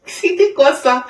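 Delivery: a puzzled laugh as she asks; laughing question. Real, not acted. Laughing while asking God.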